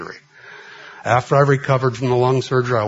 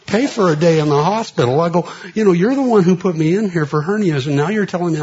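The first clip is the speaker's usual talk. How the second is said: high, louder indignant retort; indignant protest; 7 semitones higher, 4 dB louder than usual. Retold or imitated. Patient arguing with the doctor over the bill, high and indignant. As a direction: push back at him in disbelief.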